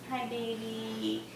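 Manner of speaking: slow, soft hello to a little one. Real, not acted. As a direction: drop to a gentle, drawn-out aside. Greeting her small child mid-sentence.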